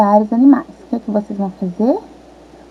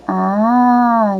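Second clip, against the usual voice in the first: usual speaking voice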